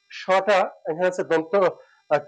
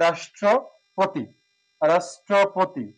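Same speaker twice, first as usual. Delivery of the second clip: slow careful demo; much slower than usual. Shown as a demonstration. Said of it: "আমরা কি রাষ্ট্রপতি বলি".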